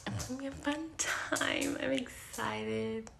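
excited whisper. Real, not acted. Whispered aside about the party.

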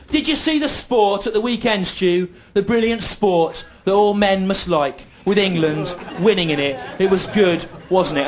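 mock schoolmates. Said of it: "people would go".

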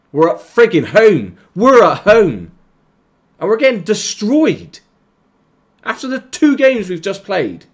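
high, loud shouting; furious rant. Real, not acted. Gamer raging at his team losing at home, furious shout. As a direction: rage at the team. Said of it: "I'm just getting annoyed now".

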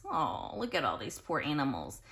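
ordinary delivery

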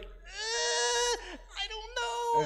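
high, whimpering unsure voice; high worried whine, on the verge of tears; nervous unsure pony. Retold or imitated. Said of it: "when you're kind of like".

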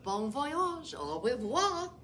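put-on lightly French-accented farewell, bon voyage; a hint of French flair on au revoir; mock French. Rabbit bids the wolf farewell in mock French. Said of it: "would a bunny speak fluent French".

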